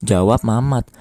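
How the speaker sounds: ordinary delivery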